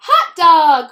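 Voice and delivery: loud, high cheer of glee; excited cry. Acted. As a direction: shout it out like a thrilled class. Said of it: "cried the class".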